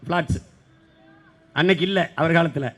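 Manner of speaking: ordinary delivery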